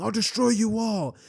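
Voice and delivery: loud, high mock-furious yell; pissed-off threat. A narrator's reading. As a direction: shout an outraged threat at the class. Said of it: "would get pissed".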